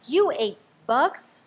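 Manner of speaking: stunned bat; stuttering surprise. A narrator's reading. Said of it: "stuttered one".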